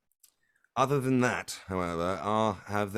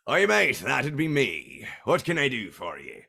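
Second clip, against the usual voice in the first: loud hearty pirate-ish captain, pitched up; sea captain; 6 semitones higher, 7 dB louder than usual. Acted. Captain greeting a stranger on deck, hearty and loud. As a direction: bellow a greeting like a salty ship captain.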